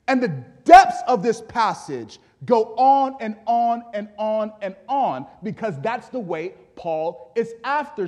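loud, high preaching voice; emphatic pulpit delivery; forceful preaching. Real, not acted. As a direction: preach it out, landing hard on the last words.

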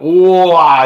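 ordinary talk

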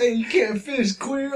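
high mocking shout; mocking yell.

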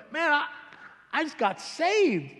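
high, slow and eager, bursting with news; excited news. Retold or imitated. Telling his drinking friend he got saved, overjoyed. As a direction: savour the words just got saved.

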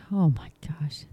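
breathy gasp of disgust, barely voiced; shocked whisper. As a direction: whisper it as if sickened by what you just read. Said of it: "I was like".

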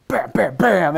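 loud, high mock sword strikes, each bam slammed out. Fan imitating Geralt's heavy stance, loud, high bams. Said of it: "and then he'd be like".

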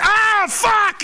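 high shouted curse; hammed-up howl of pain; mock pained yell. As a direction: yelp as if the gas hits. Gas pain.

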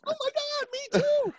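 very high falsetto shriek; fangirl squeal. Retold or imitated. Wrestler mocking himself squealing like a girl over [name]. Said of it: "we're screaming like girls".

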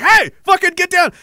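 very high, loud retold command; imitated angry shout. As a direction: yell it like a soldier at a struggler. Acting out soldier shouting an order.

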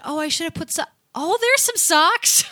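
pleased surprise. Retold or imitated. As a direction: act out finding the lost socks. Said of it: "I said".

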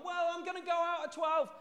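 high calling-back voice; mock shouted reply. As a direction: call the answer down to mum. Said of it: "she shouted from downstairs".

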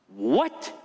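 low, incredulous "What?". Acted. Preacher reacting to joy even in death, incredulous. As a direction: react in mock disbelief. Mock shock at Paul's joy.

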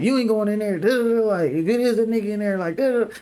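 high mock stern mom; a high, put-on scolding mother. Son quoting his mom forbidding him to go in, high mock scolding.

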